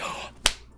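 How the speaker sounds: big mock gasp of delight; sharp, eager intake of breath; excited dog gasp. Imitating dogs as you walk in, thrilled gasp. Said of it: "they're like".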